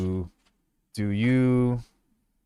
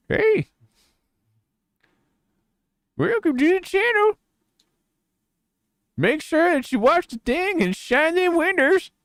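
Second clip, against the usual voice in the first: backwoods grandpa; 5 dB louder than usual. Pretend. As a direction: ramble like a backwoods old-timer. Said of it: "backwoods grandpa".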